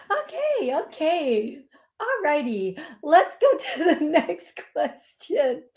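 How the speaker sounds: settling laughter. Real, not acted. Moving on to next caller, still laughing. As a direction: pull yourself together mid-giggle.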